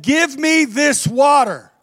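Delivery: high, loud, slow demand; excited give me this water; eager demand. Acted. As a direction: ask eagerly with an exclamation. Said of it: "Exclamation point".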